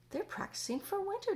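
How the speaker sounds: James. Acted. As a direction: explain it keenly. James voiced in story.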